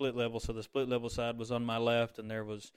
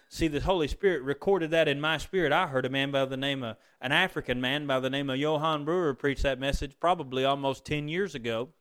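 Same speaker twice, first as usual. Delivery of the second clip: normal talking voice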